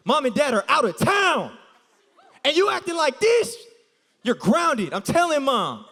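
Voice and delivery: loud, high mock scolding; hammed-up older brother laying down the law; scolding big brother. Friend posing as stepbrother scolding the girl in front of the cop, loud mock scolding. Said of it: "he played it so well".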